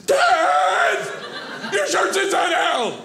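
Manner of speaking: high, loud mock yell of an embarrassed kid; hammed-up daughter shout. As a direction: squeal it out like a mortified teen daughter. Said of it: "I go".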